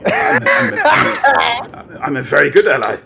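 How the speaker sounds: indignant outburst. Acted. Host protesting to guest that he is a good ally, mock-indignant yelp. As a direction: burst out in hammed-up offense. Indignant reply to "how dare you.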